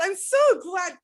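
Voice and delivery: ordinary delivery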